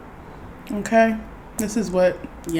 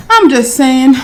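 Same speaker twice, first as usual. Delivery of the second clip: louder, higher emphatic repeat; 6 semitones higher, 6 dB louder, slower than usual. Real, not acted. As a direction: say it again with punch.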